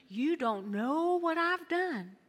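slow, high pleading; ashamed confession. Acted. A doubting churchgoer confessing to Pastor D. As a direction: confess it in shame. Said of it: "you're like".

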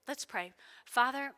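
usual speaking voice

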